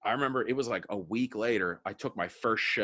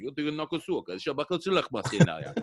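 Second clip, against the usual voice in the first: put-on higher-pitched gibberish in a Russian accent; 6 semitones higher, 5 dB louder than usual. Actor showing casting people his Russian, in a Russian accent. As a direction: imitate Russian with made-up words. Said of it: "I could do a Russian accent".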